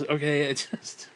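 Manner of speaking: ordinary talk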